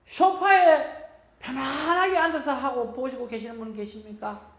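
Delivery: retold preacher thundering at the church, rising to a shout; thundering rebuke. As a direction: reenact a pastor berating his flock from the pulpit. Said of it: "막 호통을 치시는 거예요".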